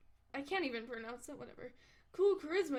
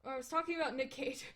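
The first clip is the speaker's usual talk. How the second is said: ordinary delivery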